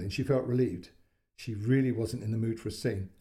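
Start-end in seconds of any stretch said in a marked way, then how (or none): none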